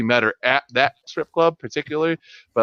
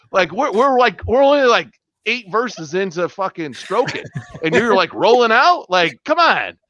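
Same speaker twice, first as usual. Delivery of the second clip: high, loud mock outrage; playfully outraged protest; indignant rant; 8 semitones higher, 3 dB louder than usual. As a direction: complain as if cheated mid-song. Indignant at dancer leaving early.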